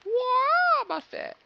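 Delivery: squealing very high. Pretend.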